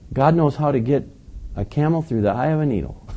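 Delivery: ordinary delivery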